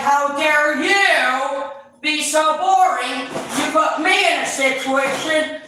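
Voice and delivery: high, loud, slow mock indignation; mock outrage. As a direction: ham up the outrage. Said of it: "You're like".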